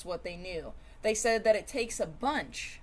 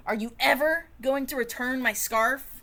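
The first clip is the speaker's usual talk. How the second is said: loud, high accusing demand; 4 semitones higher, 5 dB louder than usual. Acted. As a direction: confront them about the scarf. Confronting the lime over scarf.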